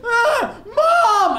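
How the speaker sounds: put-on high, loud child's shout; girl yelling for mum. Little girl shouting for her mum at the pond. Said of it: "she's going".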